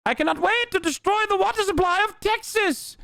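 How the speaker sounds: high-pitched villain with a hammed-up transatlantic accent; Umberto, cartoonish villain. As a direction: crow it like a moustache-twirling cartoon baddie. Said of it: "why he has a transatlantic accent".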